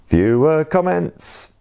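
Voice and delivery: high, slow, dramatic announcement; announcer voice. Acted. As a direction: announce it like a game show host.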